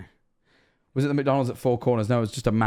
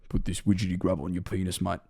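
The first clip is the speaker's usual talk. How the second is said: low, soft Australian deadpan; lightly voiced cartoon character; 6 semitones lower, 6 dB quieter than usual. Retelling the episode's prank, low offhand character voice. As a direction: say it low and casual. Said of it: "He's like".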